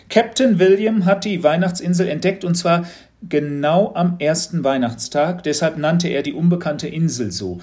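a teacher fishing for a reaction; knowing teacher, probing. A narrator's reading. Teacher tells the legend.

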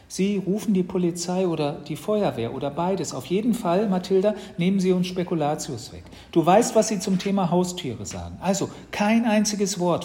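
put-on earnest, emphatic boy; urgent warning. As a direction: press the danger on your sister. Brother warns sister urgently.

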